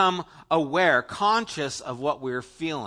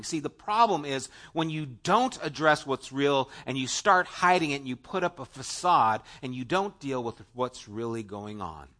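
ordinary talk, nothing marked

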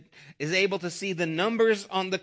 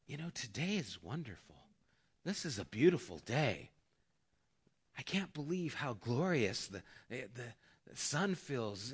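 dreamy bliss; 6 semitones lower, 10 dB quieter than usual. Retold or imitated. Stroke victim's euphoric inner voice.

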